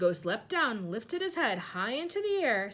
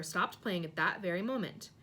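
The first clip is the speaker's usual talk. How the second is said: usual speaking voice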